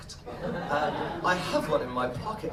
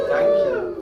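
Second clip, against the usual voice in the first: sarcastic woo; 10 dB louder than usual. Acted.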